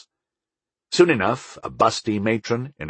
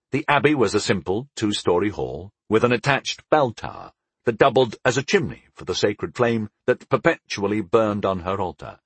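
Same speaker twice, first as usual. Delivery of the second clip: ordinary delivery, nothing marked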